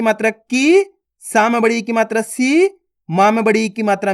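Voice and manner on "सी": "सी" held long, rising; drawn-out vowel. Shown as a demonstration. Teacher showing how to read the long mark, drawn-out "सी". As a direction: stretch the ee of "सी" upward. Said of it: "खींच खींच के पढ़ेंगे".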